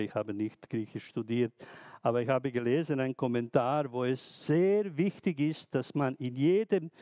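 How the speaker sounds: ordinary delivery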